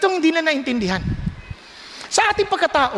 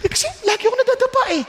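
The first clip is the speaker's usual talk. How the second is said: rising, higher, whiny complaint to the Lord; doubting believer's prayer; 6 semitones higher, faster than usual. Retold or imitated. Believer complaining to God in prayer, rising higher and whiny. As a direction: plead like a discouraged child of God. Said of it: "Kaya minsan sabi".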